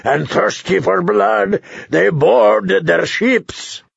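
slow, high, drawn-out recitation; declaimed verse. Acted. Tyrion reels off his rhyme for the feast, declaiming slowly. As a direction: declaim it grandly, stretching out each stressed word.

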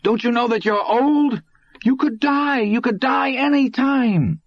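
put-on desperate teenage howl; agonized howl. A narrator's reading. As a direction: cry out in anguish at the old man. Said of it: "voice suddenly rose to an agonized howl".

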